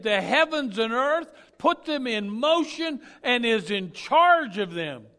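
loud, high, slow emphatic preaching; preaching at full force. Real, not acted. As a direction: drive each word home loudly to the congregation.

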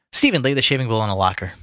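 ordinary delivery

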